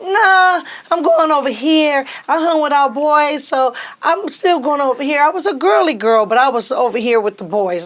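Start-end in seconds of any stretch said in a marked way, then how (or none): 0.0-5.2 high, louder, breezy; sassy refusal. Retold or imitated. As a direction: daughter brushing off mom's college advice, high and sassy. Teen refusing mom's advice.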